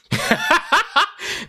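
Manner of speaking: short, high-pitched cackle; real burst of laughter. Real, not acted. Amused by therapist's verdict.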